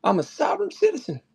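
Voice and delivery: high, whiny mock sovereign citizen. Streamer mocks sovereign citizens, high sneering mimicry. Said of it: "Jesus Christ".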